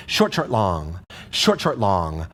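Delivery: "Short, short" quick, "long" stretched and dropping low; two quick beats on "Short, short", then hold "long"; short-short-long rhythm. Shown as a demonstration. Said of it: "'cause it's a long note".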